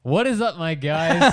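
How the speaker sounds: [name] greeting listeners as the new host; hyped greeting. Acted. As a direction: open the segment like a hype host. Host opens his segment loudly.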